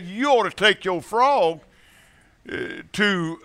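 usual speaking voice

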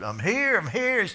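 put-on high and loud child's call; a little boy running up; boy racing to take the ticket; eager boy.

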